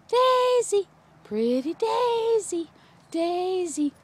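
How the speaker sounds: high, drawn-out pet call; high cooing. Real, not acted. Owner calls sweetly to dog Daisy, high cooing. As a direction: coo it high, stretching out the name. Cooing the dog's name to her.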